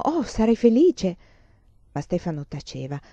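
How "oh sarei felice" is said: louder, higher happy exclamation; esclamazione felice. A narrator's reading. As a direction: exclaim it with sudden delight. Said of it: "subito Emanuela esclamò". (esclamazione di gioia, più forte e acuta)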